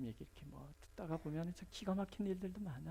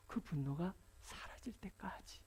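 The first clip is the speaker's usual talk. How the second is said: slow, hushed whisper; preacher whispering how long the anger lasts; sudden whisper; 6 dB quieter than usual. Real, not acted. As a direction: drop to a whisper to make them lean in. Sermon drops to whisper.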